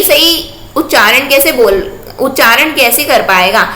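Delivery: ordinary talk, nothing marked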